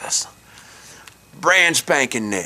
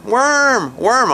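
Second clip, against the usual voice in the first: high-pitched imitation of a wailing toddler; screaming toddler; 11 semitones higher, 3 dB louder than usual. Storyteller imitating little Willie outside the camper, high mock scream. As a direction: draw each cry out high, mimicking a small boy. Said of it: "and just screaming".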